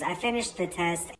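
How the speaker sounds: usual speaking voice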